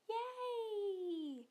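ordinary delivery

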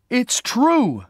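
high, loud, indignant burst; angry cry. A narrator's reading. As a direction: insist furiously. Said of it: "she cried angrily".

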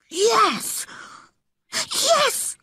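high, breathless eager yes; excited whisper. Acted. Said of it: "he whispered excitedly".

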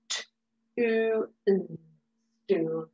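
"Stool" drawn out sound by sound. Shown as a demonstration. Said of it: "Let's sound out stool".

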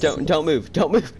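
high, loud urgent order; urgent command. Real, not acted. Player telling friend not to move the car, urgent. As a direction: call it out urgently, pitch up.

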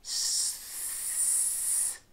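very quiet S with the tongue moved forward; whistling S. Shown as a demonstration. Teacher demonstrating the whistling forward S. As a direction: slide the S forward to the teeth till it whistles. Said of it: "move the tip of your tongue forward".

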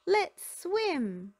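high, loud eager call; excited. A narrator's reading. Pups eager to jump into the pool, high and loud.

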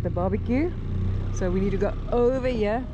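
ordinary delivery

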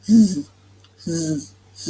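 Z as a bare buzzing "zzz"; Z phonics sound. Shown as a demonstration. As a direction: hold a short voiced buzz for each Z. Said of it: "เสียงของมันก็".

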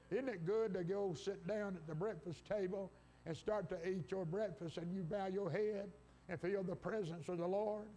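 normal talking voice

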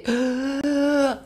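a sharp gasp for breath after coughing; imitated whooping gasp. Shown as a demonstration. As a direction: show the child finally dragging a breath in.

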